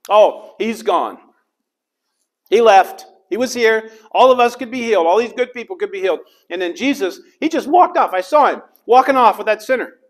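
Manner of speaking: high, loud indignant complaining; the crowd protesting, loud and upset; grumbling outrage. Acted. Crowd complaining that Jesus left with a sinner, loud indignant grumble. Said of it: "They grumbled".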